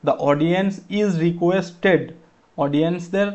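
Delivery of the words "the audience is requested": slow, word by word reading of the answer; slow dictation. Shown as a demonstration. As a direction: spell the sentence out slowly for students. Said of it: "is বসাবো".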